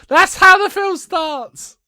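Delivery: high, loud, drawn-out punchline; loud, high-pitched amused outburst; gleeful exclamation. Real, not acted. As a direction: crow it slowly at full volume, fresh from laughing. Gleeful punchline after laughing.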